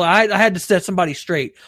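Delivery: ordinary talk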